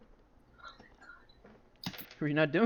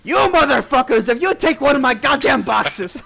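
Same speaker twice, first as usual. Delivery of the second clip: high, loud, snarling threat; furious Sable; 12 semitones higher, 12 dB louder than usual. Acted. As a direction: bark it at the thieving bandits. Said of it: "And Sable goes".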